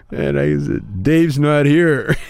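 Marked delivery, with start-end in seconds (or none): 1.0-2.2 high, loud, slow drawn-out call; dopey door answer. Retold or imitated. Chong refuses Cheech through the door, slow and high. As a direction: stretch out the punchline in character.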